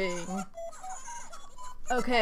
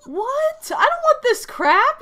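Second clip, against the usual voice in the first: much higher, louder disbelief; shocked indignation; 14 semitones higher, 7 dB louder than usual. Real, not acted. Player rejecting a junk trade offer, high-pitched indignant shock. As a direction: squeal it in outraged surprise.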